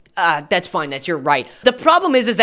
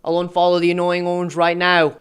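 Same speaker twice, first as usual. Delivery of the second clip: mock British accent; 2 semitones lower than usual. YouTuber reading out Jesse's apology, deadpan mock British accent. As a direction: read it in a put-on British accent, evenly pitched. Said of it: "I'm not doing an accent".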